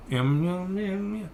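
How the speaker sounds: soft, wordless mumbling; mock a muffled voice behind a mask; mimicked unintelligible mumble. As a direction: murmur it so nothing can be understood. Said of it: "just going".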